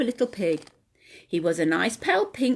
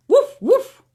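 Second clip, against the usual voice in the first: high, louder playful woof; cheerful puppy bark; dog barking; 14 semitones higher, 7 dB louder than usual. Acted. Sam the dog barks.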